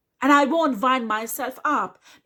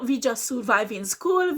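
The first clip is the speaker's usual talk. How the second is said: ordinary talk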